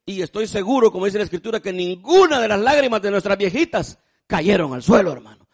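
loud, surging preacher's call; preaching at full force. Acted. Preacher urges the congregation to honor their mothers, loud fervent preaching. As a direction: drive each phrase home to the pews.

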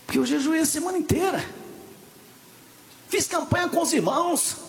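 rising into a high, preached lament; mock indignant complaint. Preacher complaining that his fasting campaign failed, mock indignant complaint.

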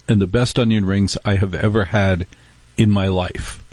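ordinary delivery

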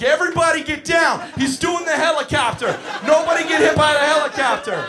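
high, loud alarm; panicked shout. Retold or imitated. Counselor warning kids about a spinning child, panicked shout. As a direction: scream a warning to a whole room. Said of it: "I lose my shit".

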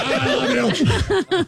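high, slow, drawn-out laughing; laughing sing-song. Pretend. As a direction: stretch it out while laughing.

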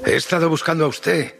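high-pitched, eager call; delighted exclamation to a stranger; radiant cry. A narrator's reading. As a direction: call out overjoyed, voice leaping up. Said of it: "grité radiante".

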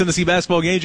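ordinary talk, nothing marked

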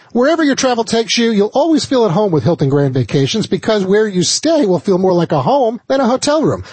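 polished sponsor read, lifted at the start; radio ad read. Acted. Host presents the vacation sponsor to listeners, radio ad read. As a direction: sell it like a radio host reading copy.

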